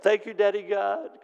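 high-pitched, eager thanks; Marge. Retold or imitated. As Marge to the God who never abuses her, eager high thanks. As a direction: imitate a worshipper piping up in praise. Voices Marge's habitual praise.

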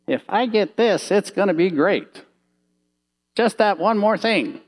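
high, eager voice of someone chasing shiny things; lured believer. Pretend. Preacher voicing a believer lured by the world, high and eager.

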